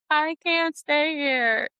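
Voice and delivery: high, drawn-out retold wail; mock breakdown. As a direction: stretch it out high, imitating a meltdown. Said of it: "I was having a Menti-B".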